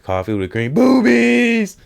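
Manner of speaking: high blurt at the end; mock slip. Comic's mock slip ordering coffee, squeaky blurt. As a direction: correct yourself then blurt it high and loud. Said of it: "Oh, I'm sorry".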